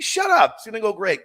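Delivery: high, loud mock-exasperated retort; exasperated reassurance. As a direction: act out someone brushing off a worrier. Retold brushing off of his nerves.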